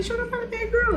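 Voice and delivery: high, pleading defense; sweet high-voiced protest; defending her friend. Mia defending Shauna to the friends, in a high, pleading voice. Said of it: "she's like".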